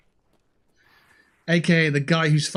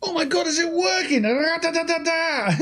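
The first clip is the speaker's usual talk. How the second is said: silly falsetto. Pretend. Streamer bursts into silly falsetto.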